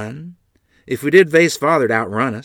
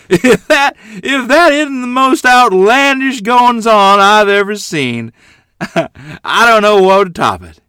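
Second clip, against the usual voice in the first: much higher and louder, roaring through laughs; exploding with laughter; 11 semitones higher, 10 dB louder, slower than usual. Acted. Grandfather watching the drunk pigs, roaring with laughter. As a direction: burst out laughing as you speak. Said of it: "Grandfather exploded with laughter".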